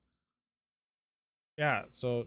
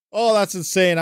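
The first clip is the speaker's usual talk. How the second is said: louder burst on OH; excited reaction; 5 semitones higher, 10 dB louder than usual. Real, not acted. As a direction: gasp out the amazement. Reacts to numbers again.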